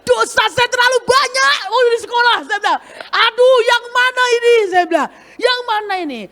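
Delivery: a hammed-up frightened wail; mock panic. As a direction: gasp at how many sins there were. Comic panic about school sins.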